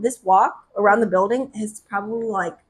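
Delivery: ordinary talk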